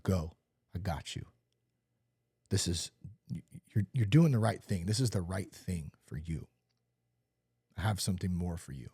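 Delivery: low, soft and reassuring; calm him like a loving father; God's gentle voice. Retold or imitated. God reassuring him at the roadside, soft and low. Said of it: "I just heard".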